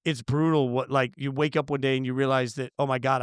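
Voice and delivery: ordinary delivery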